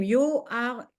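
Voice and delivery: ordinary talk, nothing marked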